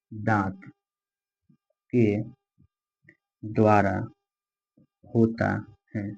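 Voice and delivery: word by word with long pauses; slow dictation. Shown as a demonstration. Teacher dictating notes word by word to students. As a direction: dictate each word slowly for writing. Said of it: "word by word dictation".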